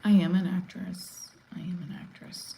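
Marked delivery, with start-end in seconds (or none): 1.6-2.6 hushed, voiceless repeat; whispered. Real, not acted. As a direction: say it under your breath, almost whispering. Repeats line, second time hushed.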